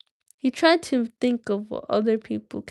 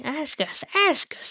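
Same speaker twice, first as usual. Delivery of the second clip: rising, pleading Gollum, much higher at the end; 6 semitones higher, slower than usual. Acted. Gollum urges Bilbo to ask, higher and higher. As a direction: needle the hobbit in a squeaky Gollum whine. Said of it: "my Gollum voice".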